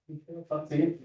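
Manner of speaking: ordinary talk, nothing marked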